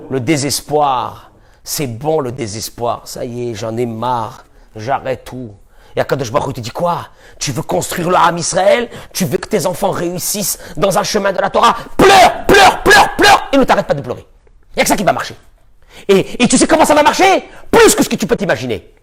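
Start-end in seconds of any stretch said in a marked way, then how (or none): 0.1-5.5 despairing. Acted. Voicing a despairing person. 12.0-14.3 high shouted pleading command; urgent cry. Acted. The teacher urging a despairing listener to weep: shouted plea.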